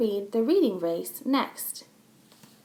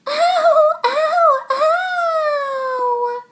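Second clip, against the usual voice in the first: very high, loud cries of pain; scream; 9 dB louder, much slower than usual. Acted. Chloe screams after Max steps on her finger. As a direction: shriek over a stepped-on finger. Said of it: "she screamed".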